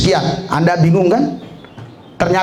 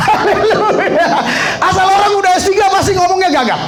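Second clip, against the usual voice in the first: laughing shout; 10 semitones higher than usual. Real, not acted. Laughing exuberant punchline.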